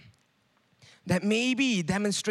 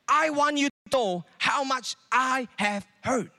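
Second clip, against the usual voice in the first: slow, higher, pleading and wounded; hurt guilt trip; 6 semitones higher, slower than usual. Pretend. Preacher voices the hurt person demanding acknowledgement, slow and higher. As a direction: make them feel your pain. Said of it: "the point is this".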